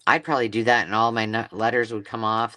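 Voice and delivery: ordinary delivery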